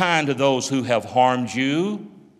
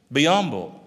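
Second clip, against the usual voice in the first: ordinary talk, nothing marked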